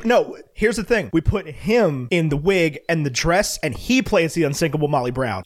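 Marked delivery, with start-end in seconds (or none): none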